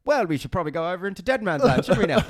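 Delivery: high, loud, quick prim mock-soldier voice; mock polite soldier. Mocking a mate in the trenches, high and prim. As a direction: ham up an overly proper trench mate. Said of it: "Are you doing the voice again?".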